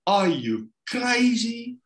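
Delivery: high, drawn-out disbelief; stretched, high-pitched astonished challenge; incredulous wife. Retold or imitated. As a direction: mimic a wife who can't believe her ears. Wife's outraged reaction, retold.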